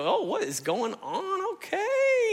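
wailing, drawn-out confusion, pitch jumping up high; mock bewildered cry. Older brother hearing the party, a hammed-up bewildered wail. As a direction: play the baffled older brother, half crying.

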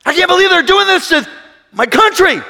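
imitating an outraged man complaining about the country; mock outraged citizen. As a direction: ham up an angry voter. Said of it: "and you're angry".

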